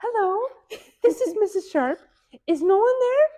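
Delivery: high, loud prim phone greeting; overly polite phone voice. Retold or imitated. Stuck mom phoning the clinic, high, loud polite phone voice. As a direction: sound sweetly polite despite distress. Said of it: "She goes".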